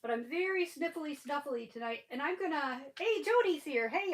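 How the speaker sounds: ordinary talk, nothing marked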